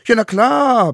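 put-on very high, loud child yell; bellowing. A narrator's reading. Smallest savage answers the court, bellowing. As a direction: yell it out at full squeaky force. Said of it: "brüllte der Allerkleinste".

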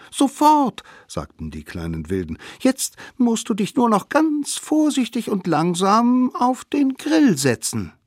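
put-on sly children plotting a trick; high scheming kid voice; little wild kids. Kids' voice.

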